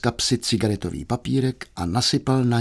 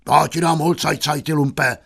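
raised, angry scolding; enraged father; 4 semitones higher than usual. Acted. Father enraged at his singing son, high angry scolding. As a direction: scold the rascal in a higher voice. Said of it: "Uslyšel zpěv a rozkatil se".

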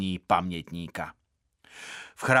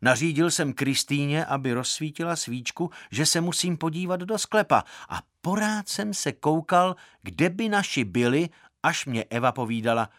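plain reading voice

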